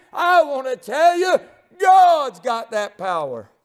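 high, slowed preaching with shouted peaks; preaching at full force. Acted. Preacher listing God's power over storms, shouting preaching. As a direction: call out each power of God, building.

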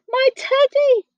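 high, excited exclamation; crying out. A narrator's reading. Storyteller voices Eddie recognising the teddy, crying out. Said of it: "cried Eddie".

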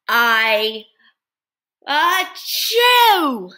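loud, high sneeze. Acted. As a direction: burst into a big sneeze. Character sneezes in story.

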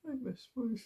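choked up, straining to get the words out; grieving parent struggling through a statement; real grief. Real, not acted. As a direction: voice pitched very high with grief. Father's statement on murdered son.

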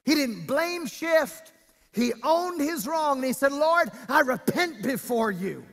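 forceful, measured sermon delivery; preaching. Real, not acted. Preacher to congregation on owning wrong, preaching. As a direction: preach it out with weight on key words.

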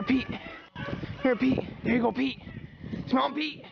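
high, encouraging calls to a hound; calling the dog. Real, not acted. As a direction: coax the dog onward in a high voice. Calling his dog to the scent.

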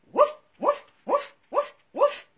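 high loud woof woof; yappy pup woofs; playful dog barks. Acted. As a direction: bark like a cheerful puppy. Dog barking in story.

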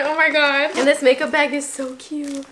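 usual speaking voice

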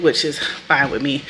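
ordinary talk, nothing marked

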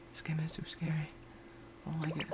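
hushed, small-voiced complaint; scared whisper. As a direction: whisper it as if spooked. Hushed complaint that game is scary.

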